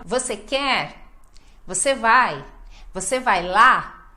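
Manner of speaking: short questions punching "quer", "vai", "lá"; final word stressed. Shown as a demonstration. Teacher drilling short questions, strong endings on "quer", "vai", "lá". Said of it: "sempre no final".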